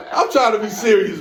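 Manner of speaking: high, loud laughing protest; failing to keep it serious, laughing. Real, not acted. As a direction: protest through your own laughter. Laughs while trying to be serious.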